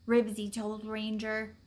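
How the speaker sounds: ordinary delivery, nothing marked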